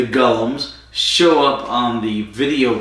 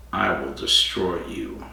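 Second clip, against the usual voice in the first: dark lord; 6 semitones lower, 6 dB quieter than usual. Retold or imitated. Sutek villain voiced in retelling.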